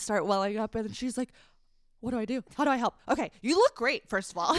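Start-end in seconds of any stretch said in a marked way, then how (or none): 2.0-4.5 quick upbeat reassurance, a bit higher and louder; hyping her up. Retold or imitated. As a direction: play the friend rushing to cheer her up. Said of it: "trying to, like, hype me up".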